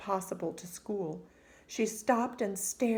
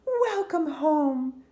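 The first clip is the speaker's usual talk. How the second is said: higher, slow, loud mother's welcome; loving mother; 9 semitones higher, 7 dB louder, much slower than usual. A narrator's reading. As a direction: greet her slowly and brightly like a mum. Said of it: "said her mother".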